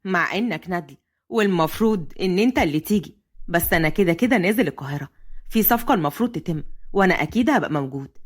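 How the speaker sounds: low, easy male voice; Fahd chatting with a friend. Acted. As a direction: banter in a deep Cairene-dialect voice. (بصوت فهد الواطي وبلهجة مصرية)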